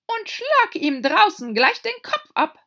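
a raging queen screaming at a court usher; furious queen. A narrator's reading. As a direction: bellow the order like a tyrant. Queen orders beheading.

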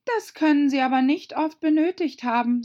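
put-on high, loud girlish voice; young Alice objecting. (hohe, laute Alice-Stimme)

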